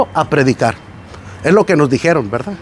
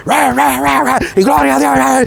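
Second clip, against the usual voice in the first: high, hammed-up "ra, ra" yelling; mock shouting prayer; 7 semitones higher than usual. Preacher mimics a shouting pastor for the congregation, hammed-up yell. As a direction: parody a pastor hollering his prayer. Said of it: "llegó y empezó a gritar".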